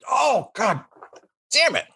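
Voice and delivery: high cry of pain and anger; pained outburst. Acted. Game master voices man cracked in the nose, pained outburst. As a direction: curse as if just hit in the nose.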